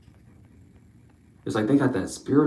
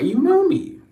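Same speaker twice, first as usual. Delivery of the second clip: squeaky high; 4 dB louder than usual. Shown as a demonstration. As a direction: jump way up in pitch.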